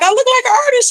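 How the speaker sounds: high-pitched incredulous question; surprised. Retold or imitated. Rapper retelling her reply to the manager, surprised. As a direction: echo it back in high disbelief.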